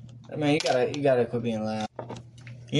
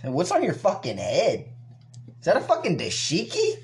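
mock intruder; 3 semitones higher than usual. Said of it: "Somebody came in from another room".

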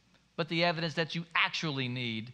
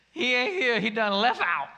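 a louder, lightly voiced flustered child blurting her line; nervous little girl; 4 semitones higher, 5 dB louder than usual. The little angel in the Easter play fumbles her line, mock flustered blurt. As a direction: mimic a nervous kid botching her big moment. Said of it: "she got nervous and she said".

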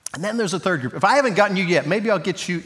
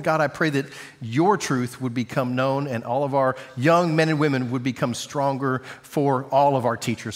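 lower-pitched, earnest prayer voice; congregational prayer; 4 semitones lower than usual. Real, not acted. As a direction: pray aloud for the teachers.

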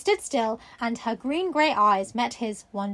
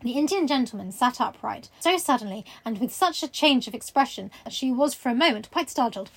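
ordinary delivery, nothing marked

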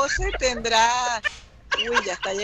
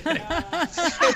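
pitched-up giggle; high laugh; 7 semitones higher than usual. Real, not acted. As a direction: laugh at your own joke. Laughs at her own joke.

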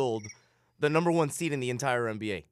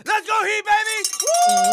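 screaming hype, much higher and louder; excited cheer; 9 dB louder, much slower than usual. Shown as a demonstration. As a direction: cheer like a rabid fan. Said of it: "You wanna hear excitement?".

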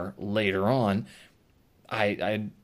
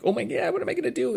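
1 mock panic; 13 semitones higher, much faster than usual. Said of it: "but not, like, panicky".

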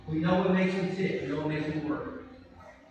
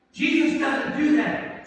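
ordinary talk

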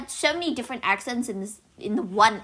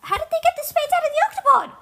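high, fast, incredulous question; heated challenge; 3 dB louder, faster than usual. Real, not acted. Arguing over how spades left the octopod, heated challenge. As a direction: fire the question back high and quick.

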